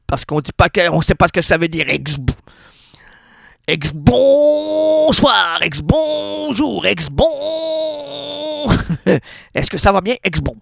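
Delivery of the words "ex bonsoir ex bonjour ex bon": high, very slow mock crying; mock wail. Mock-crying the pun to the listeners. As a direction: wail it like a fake sob.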